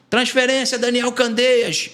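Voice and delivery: lightly voiced guard announcing transfer; guard calling out. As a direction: call the prisoner's name like a guard.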